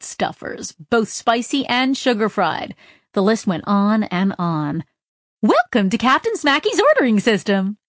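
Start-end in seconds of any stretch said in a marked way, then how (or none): 5.4-7.8 high, bright ad recording; chipper recorded voice. Acted. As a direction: sell it like a perky phone menu. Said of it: "a chipper recording exclaimed".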